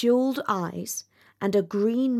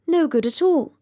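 dragonfly; 3 semitones higher, 3 dB louder than usual. A narrator's reading.

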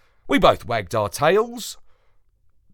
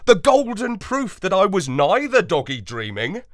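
usual speaking voice

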